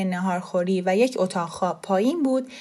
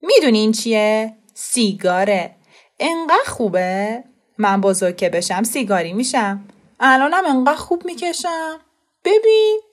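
put-on proud boy; 2 semitones higher, 6 dB louder than usual. A narrator's reading. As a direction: show off the cigarette like treasure. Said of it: "با غرور گفت". (پسربچهٔ مغرور و خودنما)

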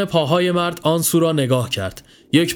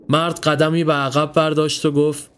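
usual speaking voice

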